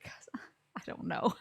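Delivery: half-whispered shrug of an answer, laughing; mock-meek whisper breaking into laughter; sheepish retold reply. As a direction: shrink into a small, whispery excuse.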